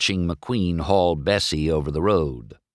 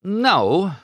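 reluctant admission; 13 semitones higher, 3 dB louder than usual. A narrator's reading. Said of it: "McQueen admitted".

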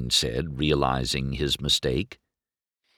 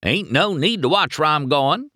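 bragging; 10 semitones higher, 4 dB louder than usual. A narrator's reading. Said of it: "he bragged".

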